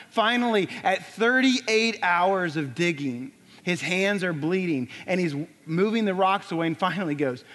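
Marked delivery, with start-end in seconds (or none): none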